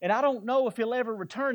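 hammed-up worried soul who feels abandoned; half-playful imitation of someone lost and afraid; despairing believer. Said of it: "has anybody ever felt that".

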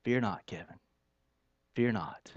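soft, low, hushed reassurance; quiet, tender calming word; gentle Spirit voice. Acted. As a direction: speak it low and gently, like a still small voice. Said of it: "I hear that voice of His Spirit".

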